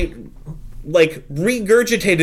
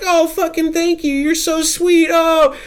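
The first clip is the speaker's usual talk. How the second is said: grateful bride; 12 semitones higher than usual. Retold or imitated. Bride's grateful reply voiced.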